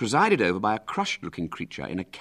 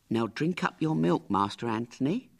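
higher, lightly voiced meek nurse; timid nurse; 7 semitones higher than usual. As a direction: coax the spoilt boy to drink. Crushed nurse coaxes the boy.

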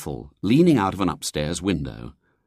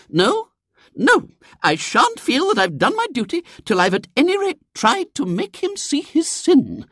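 very high, louder, insistent lady; righteous crusading lady; 5 dB louder than usual. A narrator's reading. Lady insists on confronting William's father, righteous.

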